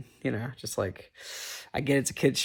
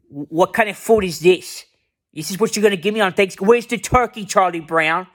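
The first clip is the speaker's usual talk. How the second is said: put-on high, louder ranting kid voice; imitating a furious girl yelling about food; mock angry rant; 6 semitones higher, 3 dB louder than usual. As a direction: rant at Charlie Brown, getting shriller. Peppermint Patty losing her shit.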